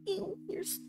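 high, slow mock disgust; disgusted mom. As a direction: imitate a grossed-out mom. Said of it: "my mom would be like".

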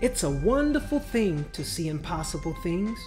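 usual speaking voice

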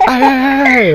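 retold shouting. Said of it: "And you're like".

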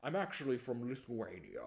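slow Scottish-accented actor impression; Sean Connery. Host mocks actor claiming to be Russian, Scottish impression. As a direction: draw it out in a Scottish burr, slushy "sh". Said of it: "He's like".

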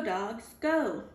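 plain reading voice

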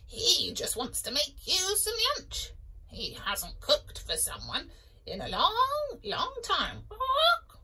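high clucky hen; chicken voice. Acted. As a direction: chatter like a chicken. Chicken speaking.

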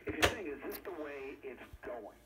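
normal talking voice

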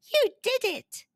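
high-pitched yell, kept light; mild yell of triumph to a friend; excited yell. A narrator's reading. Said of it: "yelled Blue".

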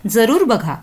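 usual speaking voice

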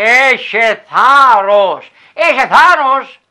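very slow, loud, high encouragement; angels encouraging. Acted. Angels urge the girl in the woods, very slow and loud. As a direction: stretch each word out like a blessing. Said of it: "Μου λέγανε".

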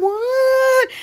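high-pitched astonished "what"; high, incredulous retold exclamation; retold disbelief. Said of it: "I was like".

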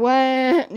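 drawn-out fake wail; hammed-up baby "wah"; mock crying. Gamer taunting a rival as scared, mock wailing.

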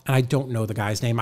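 ordinary talk, nothing marked

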